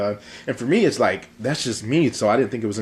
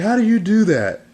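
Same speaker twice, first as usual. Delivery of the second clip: put-on little girl voice; 13 semitones higher, 10 dB louder than usual. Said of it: "She's like".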